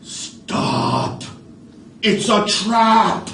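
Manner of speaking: high, loud cry of alarm; raven's warning. Acted. Raven warns hungry birds, loud alarmed cry. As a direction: shout the warning from the treetop.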